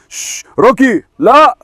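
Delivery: high, loud no; sharp shout. Real, not acted. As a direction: stop the dog cold. Stopping the dog loudly. (زعقة عالية)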